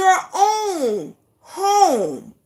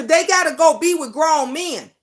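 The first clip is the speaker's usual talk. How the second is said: ordinary talk, nothing marked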